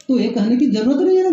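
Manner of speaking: usual speaking voice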